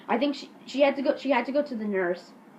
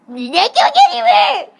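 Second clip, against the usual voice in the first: very high, loud cartoonish yell; hammed-up squawking threat; mock bully threat; 13 dB louder than usual. Eric threatens Jake by the hair, high mock yell.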